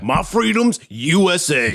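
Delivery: slow, high, hammed-up patriot drawl; mock brash American. Still mocking the American answer, slow patriot voice. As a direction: lean into the proud American parody. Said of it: "that's such an American response".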